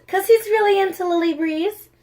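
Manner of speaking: high, bright teasing restart; teasing lilt. Acted. Friend teasing Lily about a crush, high teasing lilt. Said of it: "restarted with teasing lilt".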